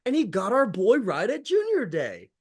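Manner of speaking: high, swooping mock European accent; imitated European accent. Fan relishing a player's tweet, high mock European accent. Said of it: "He has the funnest voice".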